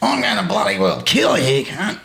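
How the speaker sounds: laughing mock Aussie warlord threat; a hint of Australian accent, half laughing. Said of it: "in an Aussie accent".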